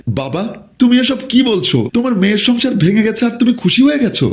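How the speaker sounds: high-pitched, hurt disbelief; acted distraught questioning, pitch way up; shocked protest. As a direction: confront your father in wounded shock. Daughter shocked by father's cruel reply.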